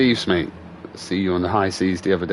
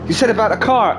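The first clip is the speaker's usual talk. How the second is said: high-pitched mock insistence; protesting friend; 11 semitones higher than usual. Distracted friend caught not listening, high protesting retelling. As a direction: imitate a mate bluffing that he was listening.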